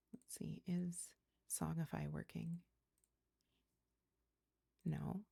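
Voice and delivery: soft breathy whisper. Real, not acted. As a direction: whisper it to yourself. Whispered aside.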